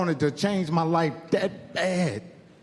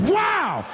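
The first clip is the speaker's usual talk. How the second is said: gunshot yell; 11 semitones higher than usual. Shown as a demonstration. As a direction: blast it like the gun finally fires. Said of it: "it skipped like this".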